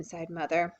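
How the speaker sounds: plain reading voice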